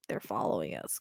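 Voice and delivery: hushed, low retelling of whispered words; imitated whispering. Girl to her friend about boys behind them on a trail, lightly imitated whispering. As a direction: drop low and soft, half imitating a whisper. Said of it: "they're whispering back and forth about".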